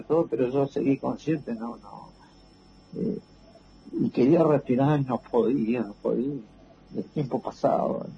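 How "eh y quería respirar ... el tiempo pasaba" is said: ordinary talk, nothing marked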